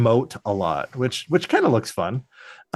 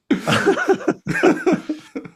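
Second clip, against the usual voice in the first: high squealing laugh. Real, not acted. Laughing hard.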